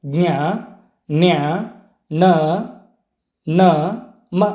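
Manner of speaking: ಜ್ಞ, ನ್ಯ, ನ, ನ, ಮ sounded through the nose; letter by letter, ಜ್ಞ, ನ್ಯ, ನ, ನ, ಮ as nasals, with pauses between; nasal consonants. Shown as a demonstration. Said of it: "ಮೂಗಿನ ಸಹಾಯದಿಂದ ಉಚ್ಚರಿಸಲ್ಪಡುವಂತಹ".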